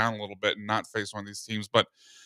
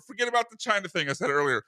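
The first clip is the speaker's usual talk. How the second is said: usual speaking voice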